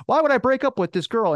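high, loud retold protest; acted-out outraged question; indignant disbelief. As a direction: argue it like it's absurd, voice shooting up. Retold protest about breaking up.

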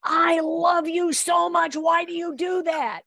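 high, loud slow exasperated cry; mock exasperated dog talk. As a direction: shout your love at the dog, fed up.

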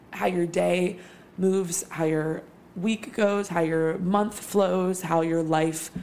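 slow, measured poetic cadence; spoken-word rhythm with a beat per line. Shown as a demonstration. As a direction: lay each line out slowly like verse. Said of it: "Mm, that was poetic".

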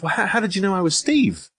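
imitating a baffled man, pitched well up; baffled question. Troll caught out by his own name, high baffled question. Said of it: "he goes".

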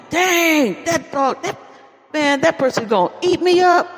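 high, startled cry of alarm; startled exclamation. Retold or imitated. Preacher voices startled helper.

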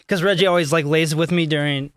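usual speaking voice

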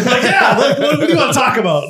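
high, loud, quick through laughter; giddy and high-pitched; laughing mock reply. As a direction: laugh your way through an eager quote. Acted quote, laughing.

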